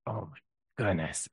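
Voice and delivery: low, quiet mock disbelief; exasperated dismay. Late driver stuck in standstill traffic, quietly exasperated. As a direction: sigh it out softly and low.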